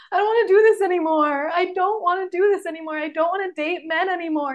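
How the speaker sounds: high, loud, breaking imitation of a sob; retold bawling. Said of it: "I just started bawling".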